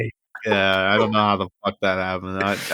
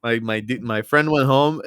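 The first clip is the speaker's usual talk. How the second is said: usual speaking voice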